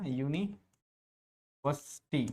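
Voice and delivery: "यूनिवर्सिटी" split into yu-nee, pause, vas-tee; "यूनिवर्सिटी" in parts, without the r; broken-down pronunciation. Shown as a demonstration. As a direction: sound out "यूनिवर्सिटी" in two chunks. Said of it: "आपको pronunciation देखना है".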